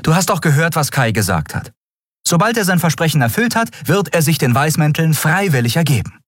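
high, insistent captain's voice; Endriel, defending Kai. Acted. Captain defending Kai's promise, high and insistent. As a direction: argue back eagerly in a high voice.

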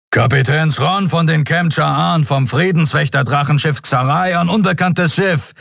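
high-pitched, forceful hailing voice; booming captain. A narrator's reading. Patrol captain hailing an unknown ship, booming. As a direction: hail them like a commanding captain. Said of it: "dröhnte es aus dem Kubus".

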